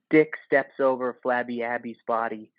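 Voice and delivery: ordinary delivery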